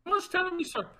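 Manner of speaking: insistent mock Indian accent. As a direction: badger him in a fake Indian accent. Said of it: "talking in a fucking Indian accent".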